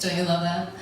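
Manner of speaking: ordinary talk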